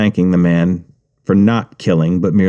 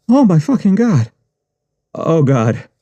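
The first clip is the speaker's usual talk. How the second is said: pained moan; 7 semitones higher than usual. Acted. In pain after shot.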